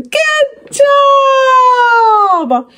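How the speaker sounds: high, drawn-out cheer; big praise. Real, not acted. To the child after the planet list, cheering.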